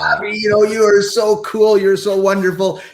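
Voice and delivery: high, mocking, sarcastic gushing; sarcastic praise. Shown as a demonstration. To show how tone changes the same words, sarcastic. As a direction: lay on the fake enthusiasm thick. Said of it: "the words are not that different, but the experience is completely different".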